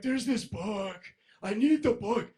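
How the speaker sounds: frantic shout. Retold or imitated. Acting out his own frantic request.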